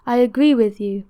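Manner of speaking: a weary stranger speaking with conviction; grieving stranger. A narrator's reading. Stranger voiced by reader.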